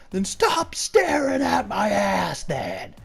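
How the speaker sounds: high, drawn-out mock shriek; imitated yell. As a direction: yell it out of the blue, high and slow. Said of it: "just randomly yells this".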